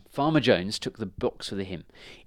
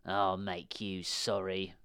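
slow, softer witch's threat; menacing witch; 2 semitones lower, 6 dB quieter, slower than usual. A narrator's reading. Witch warning the girl, slow menace. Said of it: "the witch said".

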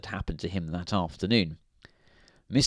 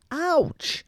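a sudden, much higher yelp of ouch. A narrator's reading. Mister Happy's foot stamped on by Mister Grumpy, high yelp.

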